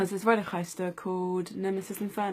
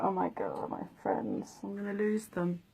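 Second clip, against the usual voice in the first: imitated crying. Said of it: "and I just started crying".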